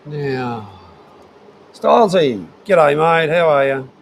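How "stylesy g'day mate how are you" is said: loud, higher-pitched hail to a mate; hearty greeting. Real, not acted. Streamer hails a viewer who just joined, loud greeting. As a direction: call out a big g'day.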